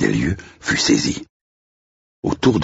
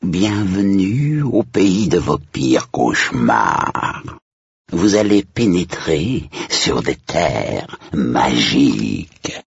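very slow, far-off ghost butler; sepulchral voice; 4 semitones higher, much slower than usual. Acted. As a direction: intone it from beyond the grave. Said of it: "d'une voix sépulcrale".

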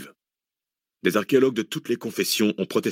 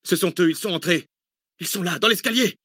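high, panicked warning; Zach; 10 semitones higher than usual. A narrator's reading. Man warns that attackers are on the stairs, alarmed cry. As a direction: cry it out in alarm, pitch well up. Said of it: "cria Zach".

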